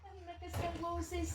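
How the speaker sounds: soft "Ang lakas ng boses" said almost to herself; hushed aside. Real, not acted. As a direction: mutter it under your breath. Remark on the loud pet.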